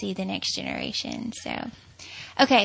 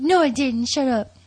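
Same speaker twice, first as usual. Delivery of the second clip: louder, slightly raised mock protest; retold denial; 3 semitones higher, 5 dB louder than usual. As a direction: imitate his defensive comeback. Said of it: "And he was like".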